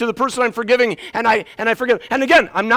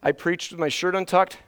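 ordinary talk, nothing marked

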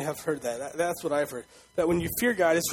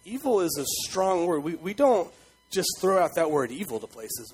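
ordinary delivery

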